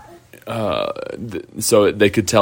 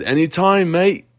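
higher, a light mock Australian twang; Australian accent; 8 semitones higher than usual. Friends answering Lars's thanks, light mock Australian accent. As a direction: toss it off Aussie-style. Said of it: "I thought I had the accent".

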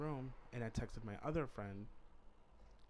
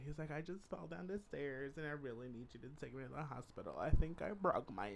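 higher, hammed-up pained friend's voice; mock injured friend; 4 semitones higher than usual. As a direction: play the fake emergency, overdoing the pain. Friend fakes injury to rescue him.

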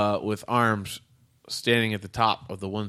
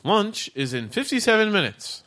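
high even robot voice; 9 semitones higher than usual. Acted. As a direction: answer like a machine, pitched up. Robot answers.

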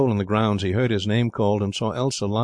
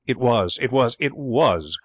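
mildly petulant, slowing insistence; a touch of spite, drawn out; spiteful cry; 3 semitones higher, slower than usual. A narrator's reading. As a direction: insist stubbornly, dragging each repeat. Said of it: "cried Elsa, thumping the pillow malignantly".

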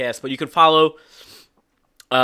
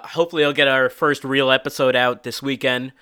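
normal talking voice

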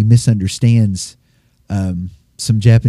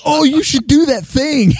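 obnoxious American tourist. Retold or imitated. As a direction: play a grating American tourist. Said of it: "really stilted".

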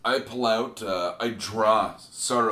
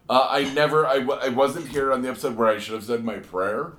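normal talking voice

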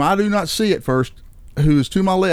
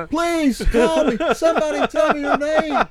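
retold screaming; 9 semitones higher than usual. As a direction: plead as if yelling through glass. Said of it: "I'm still screaming through the glass".

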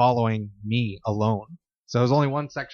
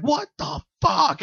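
high, slow, startled "what the fuck"; freaked out; slower than usual. Retold or imitated. Retelling his friend Ashley's scared reaction, a high slow shriek. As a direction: pitch up into her panic.

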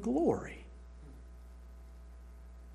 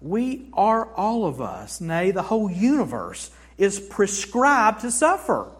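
ordinary delivery

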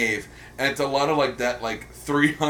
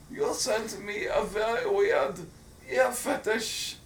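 put-on German accent; 8 semitones higher than usual. Co-host paying off the accent bit, mock German accent. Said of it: "pay off the talk about accents".

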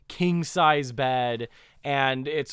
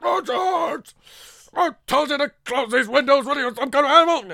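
much higher, loud furious yelling; screaming for the butler; 13 semitones higher, 8 dB louder than usual. Retold or imitated. Villain yelling at his butler about windows, furious shout. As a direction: bellow the name across the house. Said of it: "he's screaming for his butler's name".

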